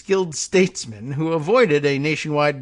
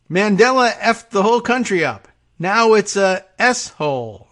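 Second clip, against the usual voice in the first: louder, higher mock outburst; mock Trump rant; 6 semitones higher, 5 dB louder than usual. Host parodying Trump's words about Mandela, mock loud rant. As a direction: bellow it like a mock tirade. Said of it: "I guess he said".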